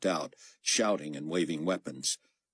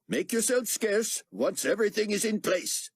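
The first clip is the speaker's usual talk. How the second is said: raised, lightly voiced exchange; higher voices for the officer and the steward; character voices. A narrator's reading. Akbar giving orders to the steward, lightly voiced.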